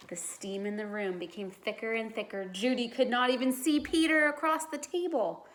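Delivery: usual speaking voice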